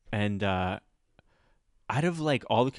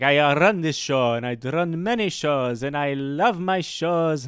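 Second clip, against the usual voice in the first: put-on arrogant Italian accent; 2 semitones higher, 4 dB louder than usual. Comic imitating the Italian show runner, arrogant Italian accent. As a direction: boast like an overcompensating Italian showman. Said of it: "a arrogant Italian from Italy".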